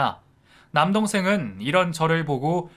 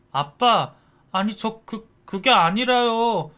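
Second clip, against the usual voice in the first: high, slow stammering excuse; flustered son; 8 semitones higher, 5 dB louder, slower than usual. A narrator's reading. Son stammering excuses to his angry father, high and flustered. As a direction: scramble for an excuse, scared.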